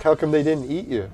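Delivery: ordinary talk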